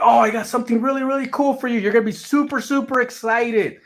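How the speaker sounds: high, bubbly mock excitement from the girlfriend; excited. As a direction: gush like she has big news. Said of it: "She's like".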